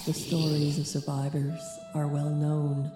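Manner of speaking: eerie slow whisper; whispered narration. Acted. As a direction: breathe it out slowly.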